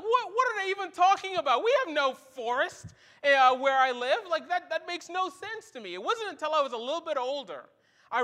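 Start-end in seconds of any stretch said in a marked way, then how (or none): 0.0-5.9 put-on baffled kid. Young self baffled by elders' saying.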